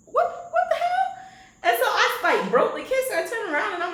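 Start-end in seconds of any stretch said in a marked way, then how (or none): 0.1-1.2 high, slow shocked outburst; squeaked startled outrage; startled what the hell. Retold or imitated. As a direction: jump with shock. Startled by a hand on her neck.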